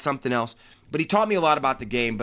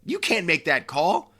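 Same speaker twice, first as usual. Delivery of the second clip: high incredulous what what, the second pair jumping even higher; mock outrage at the referee's call, rising on each what; retold disbelieving outburst; 6 semitones higher, slower than usual. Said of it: "I'm like".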